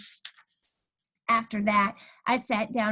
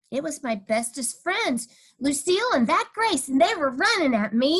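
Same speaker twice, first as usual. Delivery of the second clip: usual speaking voice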